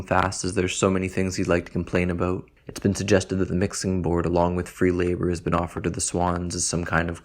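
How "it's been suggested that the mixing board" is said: ordinary delivery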